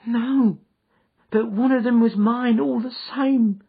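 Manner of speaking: whining. Acted. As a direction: whine like a cringing little man. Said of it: "whined the little man".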